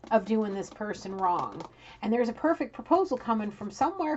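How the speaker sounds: normal talking voice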